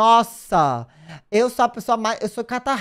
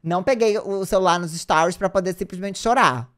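ordinary talk

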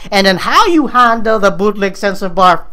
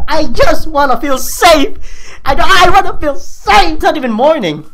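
pleading; 7 semitones higher, 3 dB louder than usual. Real, not acted. Pleading after scare.

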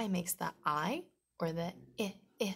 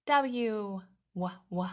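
normal talking voice

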